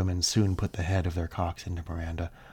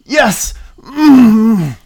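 loud moaning cry; 17 dB louder than usual. Acted. Character cries out in pleasure.

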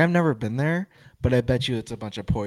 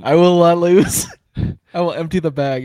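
loud; 4 semitones higher, 11 dB louder than usual. Pretend. Crude joke, said big.

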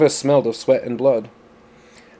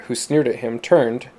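plain reading voice

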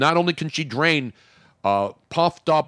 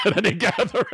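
laughing; faster than usual. Real, not acted. Host joking about yelling at family, breaking into laughter. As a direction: voice climbing into high laughter. Host cracks up at own joke.

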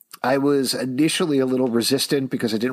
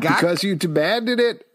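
high hammed-up promo voice; mock announcer; 8 semitones higher, 3 dB louder than usual. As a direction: hype it like a trailer.

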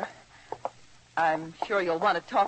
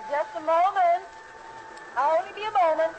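raised voice, higher, called from off; calling out; 8 semitones higher than usual. Acted. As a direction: call it out from the back room. Calling from another room.

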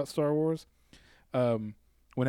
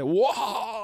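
higher mock cry of alarm; mock pilot whoa; 7 semitones higher than usual. Pilot crashing into the bridge, high mock whoa. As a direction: yelp it like a pilot going down.

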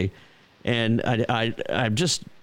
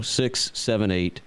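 slow number readout; 3 semitones lower, slower than usual. Shown as a demonstration. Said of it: "repeats the phone number".

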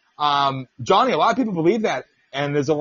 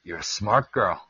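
much lower, chuckling impression of Jesse; low amused Jesse; 10 semitones lower, 4 dB quieter than usual. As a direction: drop low and chuckle through it. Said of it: "he just looks at her and goes".

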